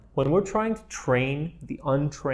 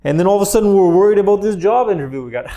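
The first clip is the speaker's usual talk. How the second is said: faster, louder, higher, as if the mind suddenly panics; hammed-up worry spilling out; mock worried rush; 6 semitones higher, 8 dB louder, faster than usual. Shown as a demonstration. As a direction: rush the words like a racing thought.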